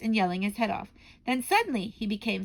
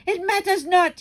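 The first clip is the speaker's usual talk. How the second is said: much higher, loud dismissive shout; witch shouting; 10 semitones higher, 9 dB louder than usual. Acted. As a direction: shout down the hall. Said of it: "shouted the Grand High Witch".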